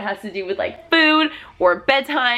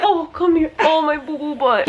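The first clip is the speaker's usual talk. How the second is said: fond parent comfort, pitched up; 5 semitones higher than usual. Real, not acted. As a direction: coo as if gathering up a little one. Comforting toddler who fusses.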